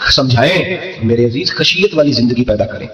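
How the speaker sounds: usual speaking voice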